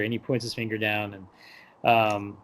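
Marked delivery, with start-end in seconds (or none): none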